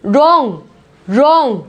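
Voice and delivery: रॉन्ग with a plain R; wrong as रॉन्ग; silent W. Shown as a demonstration. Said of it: "W यहाँ पे silent है".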